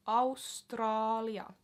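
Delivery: Australia said slowly the Finnish way, OW-strah-lee-ah; slow Finnish Australia, ow at the start; slow demo. Shown as a demonstration. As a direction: stretch Australia out for learners. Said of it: "repeating word for learners".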